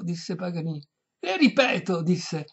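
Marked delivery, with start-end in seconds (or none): 1.2-2.0 high, loud restatement; exasperated. A narrator's reading. Violinist repeating himself to deaf lady, exasperated loud voice. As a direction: hammer it out high.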